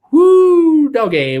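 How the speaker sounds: a high, loud whoop of disbelief; excited whoop. Real, not acted. Host reading a heat comment, whooping loudly at the forecast. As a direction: let out a big woo, then doggy.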